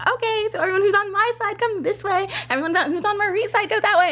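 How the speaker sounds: high, perky choreographer calling directions; lightly voiced cheerful Callie; hint of a chipper dance captain; bubbly idol leader. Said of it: "Callie ... being like".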